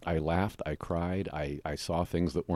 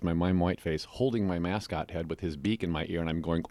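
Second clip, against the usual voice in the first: ordinary delivery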